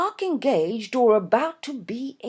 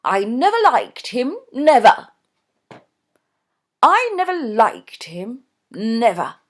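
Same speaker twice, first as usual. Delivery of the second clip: loud, firm, insistent mother; firm Mrs Norbury; 4 semitones higher, 9 dB louder than usual. A narrator's reading. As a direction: hammer it home as the stubborn mother. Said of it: "said Missus Norbury firmly".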